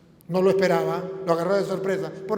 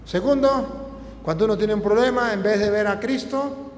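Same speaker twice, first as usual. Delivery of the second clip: louder, higher preaching push; preaching at full force; 4 semitones higher, 5 dB louder than usual. Acted. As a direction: drive the point home from the pulpit.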